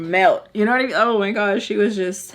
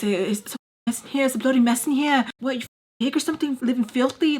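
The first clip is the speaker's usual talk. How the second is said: higher-pitched mock scolding, no real volume; mock yelling; 4 semitones higher than usual. Man complains to her about the mess, mock yelling. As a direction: imitate a man telling her off, without shouting. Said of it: "Like, yelling at her".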